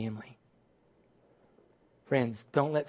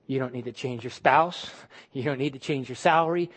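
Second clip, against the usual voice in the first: usual speaking voice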